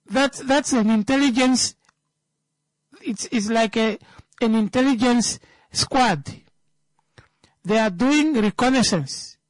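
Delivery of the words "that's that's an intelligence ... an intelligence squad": laughing. Real, not acted. Laughs at his own joke.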